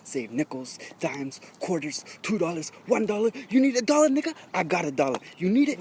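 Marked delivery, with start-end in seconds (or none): none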